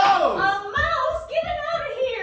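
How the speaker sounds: high panicked scream; frightened shriek. Acted. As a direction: shriek in alarm at a mouse. She is frightened by the mouse.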